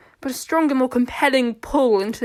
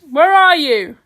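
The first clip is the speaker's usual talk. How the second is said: loud searching call; raised voice, hunting for someone; 4 semitones higher, 10 dB louder, slower than usual. A narrator's reading. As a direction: call out higher and much louder. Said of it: "she called out".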